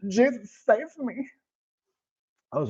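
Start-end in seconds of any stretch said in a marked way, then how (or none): 0.1-1.3 high, hammed-up cry to Jesus; mock desperate plea. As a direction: beg Jesus for rescue in a squeaky pretend voice.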